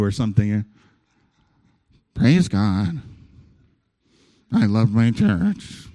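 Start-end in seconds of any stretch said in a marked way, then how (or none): 2.1-5.9 forced fake praise. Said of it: "You look like you're, a lion's behind you".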